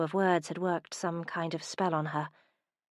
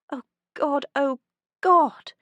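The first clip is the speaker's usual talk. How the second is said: from a higher cry to a loud, much higher wail; building horrified cry; 8 semitones higher, 10 dB louder than usual. Acted. Humiliated heroine cries out, building to a loud wail. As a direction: let the panic climb to a near shout.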